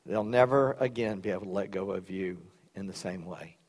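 soft, low prayer voice. Real, not acted. Pastor praying over the congregation, soft and reverent. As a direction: pray it quietly and reverently. Closing prayer before the sermon.

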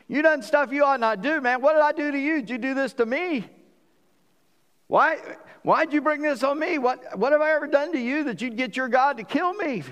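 a king demanding why he was wronged, heated and high; pleading outrage. Acted. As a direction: hurl the accusations at him, climbing. Abimelech confronts the prophet, paraphrased.

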